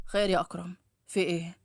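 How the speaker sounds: put-on low, curt older man's voice; wary father. A narrator's reading. Nazih finding Akram at his door, low and wary. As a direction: ask suspiciously in a deep male voice.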